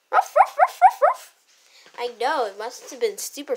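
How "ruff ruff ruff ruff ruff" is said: high, loud yappy barks; excited barking. Pretend. The dog answers his owner with excited barks. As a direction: yap happily like an excited puppy.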